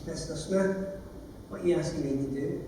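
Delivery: a hint of the judge's polite, quiet voice; imitating the judge. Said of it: "this is her actual voice".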